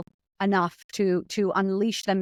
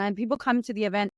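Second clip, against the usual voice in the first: usual speaking voice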